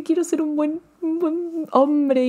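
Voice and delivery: high, slow, soft and earnest son's plea; lightly voiced Jack, high and hushed, drawn out slowly; imitating Jack. Vlogger quoting Jack telling his father on the phone, high soft earnest. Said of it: "le dice".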